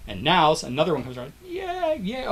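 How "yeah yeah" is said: soft, high mock cheer; faraway fan's cheer; small wave cheer. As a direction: squeal a little pretend "yeah" softly. Wave comes round from others.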